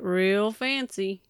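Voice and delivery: playful slip into a Southern twang. Real, not acted. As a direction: draw the words out with a Southern twang. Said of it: "What is that voice?".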